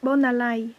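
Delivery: "bonalai" said carefully, ending in an "aïe" glide; pronunciation demo. Shown as a demonstration. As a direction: model "bonalai" with a clear final "aïe" sound. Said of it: "sachez que ça se dit".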